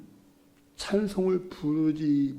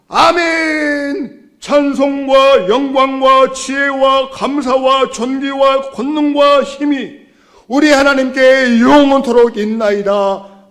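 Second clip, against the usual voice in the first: high, booming shout of praise; angel choir shout; 9 semitones higher, 16 dB louder, slower than usual. Acted. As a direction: proclaim it like a heavenly host. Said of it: "천군 천사들이 함께 모여서 화답합니다". (천사들의 우렁찬 외침)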